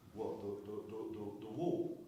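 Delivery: flustered halting stammer, quiet. Retold or imitated. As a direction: trip over the words, caught out. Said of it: "I stammer".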